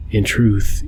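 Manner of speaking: usual speaking voice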